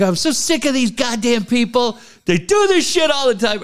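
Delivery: high-pitched comic rant; heated. Retold or imitated. Fed up with these people to an ex, heated. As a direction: vent in mock exasperation, pitched high. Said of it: "it got me all heated".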